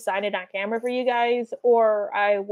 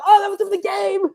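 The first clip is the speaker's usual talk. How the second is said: high, pressed exclamation; mock excited outburst; excited recognition; 9 semitones higher than usual. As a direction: blurt it out as if you just recognized it. Acts out own excited recognition.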